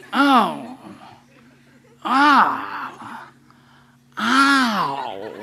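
hammed-up little groans of discomfort; mock pained groans. Preacher mimics a listener suffering through the sermon, mock pained groans. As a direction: moan like it hurts to hear.

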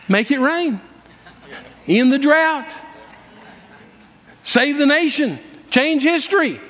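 high, ringing commands with long pauses; bold proclamation. Retold or imitated. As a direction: declare each one like an order. Retold bold commands in answer.

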